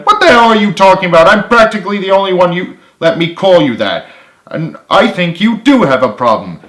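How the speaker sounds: high, loud and fast; angry outburst. A narrator's reading. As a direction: rattle it off in anger. James yelling at Carly.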